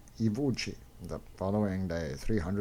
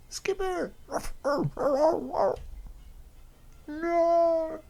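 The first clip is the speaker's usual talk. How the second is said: high, loud anguished scream; desperate cry; 4 dB louder, much slower than usual. Acted. Young lad screams for his skipper, loud and high.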